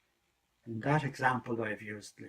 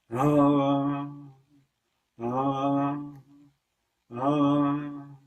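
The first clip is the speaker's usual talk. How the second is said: long ah chant; 3 semitones higher, 8 dB louder, much slower than usual. Acted. Wolf's drawn-out prayer.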